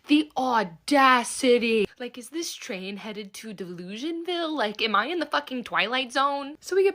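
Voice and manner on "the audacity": slow outraged emphasis. Real, not acted. Outraged aside about his nerve.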